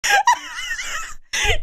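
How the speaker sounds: a high shrieking laugh of pure delight; delighted squealing laugh. Real, not acted. Streamer thrilled by the case, shrieking laugh.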